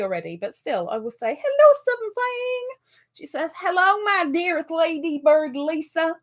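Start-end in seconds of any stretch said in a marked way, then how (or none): 1.4-2.7 high, singsong Southern hello; hammed-up Southern twang, pitched high; mock Southern accent. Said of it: "hasn't quite got that accent". 3.6-6.2 higher-pitched Southern belle attempt; not-quite-right Southern twang; mock Southern accent. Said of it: "hasn't quite got that accent".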